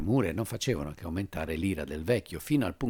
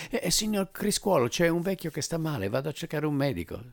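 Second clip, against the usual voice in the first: higher, hurried; young narrator, eager; 10 semitones higher, 4 dB louder than usual. Acted. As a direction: rush the news to the major. Narrator reports to the major.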